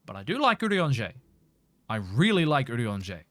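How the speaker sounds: normal talking voice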